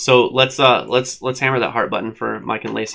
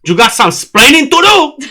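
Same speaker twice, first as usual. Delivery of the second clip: hammed-up Ricky Ricardo accent, much higher and louder; 13 semitones higher, 9 dB louder, slower than usual. Scolding the dog with hands on hips, mock Ricky Ricardo accent. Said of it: "don't give me the accent".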